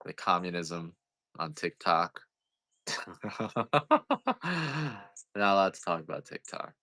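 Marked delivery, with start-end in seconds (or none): 2.9-5.0 soft rising laugh; high laugh. Real, not acted. Teasing viewers about TikTok, soft high laugh. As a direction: chuckle up high and let it fall.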